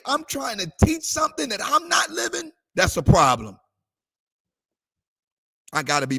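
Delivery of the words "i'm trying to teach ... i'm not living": a high, sung confession from the pulpit; high pleading quote. Retold or imitated. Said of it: "You're gonna say".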